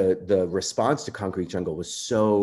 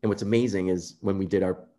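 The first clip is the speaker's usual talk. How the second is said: ordinary talk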